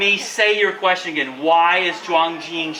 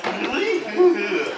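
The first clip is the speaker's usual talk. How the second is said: happy laugh. Shown as a demonstration. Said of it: "And with a big smile".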